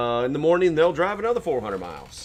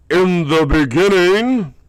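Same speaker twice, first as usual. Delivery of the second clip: loud, slow, booming opening words; grand announcement; 5 semitones higher, 12 dB louder, slower than usual. Acted. Announcing a grim forecast to viewers, loud and slow. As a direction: boom it out like the start of an epic.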